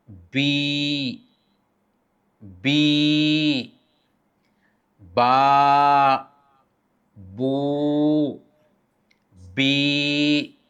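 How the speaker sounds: बी, बा, बू held long and steady; long vowel drill. Shown as a demonstration. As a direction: sustain every vowel evenly. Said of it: "हरकत तवीला में". (लंबी आवाज़ में बी, बा, बू)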